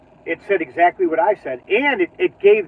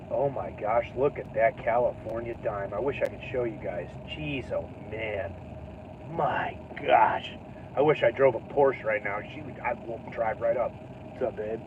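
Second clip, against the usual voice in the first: much softer, low, half to himself; hushed aside; 10 semitones lower, 11 dB quieter than usual. Real, not acted. Vlogger muttering to himself about a passer-by, hushed. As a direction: murmur it like nobody should overhear.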